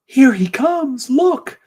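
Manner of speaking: very high, pleading, with a gasp, then slow and loud; a frantic lady spotting her bird outside; woman at the train window, begging, then amazed; desperate woman. Acted. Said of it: "And the woman leans out".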